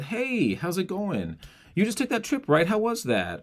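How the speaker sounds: high, open, easy-breath hello to a friend; curious friendly greeting. Shown as a demonstration. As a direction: sound genuinely interested in their trip. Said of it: "me being curious and interested in you".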